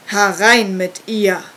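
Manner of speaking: loud, slow, booming order from inside; a deep voice barking from the cave; deep commanding voice. A narrator's reading. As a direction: call her in like a dark cult master. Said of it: "wies eine tiefe Stimme von drinnen an".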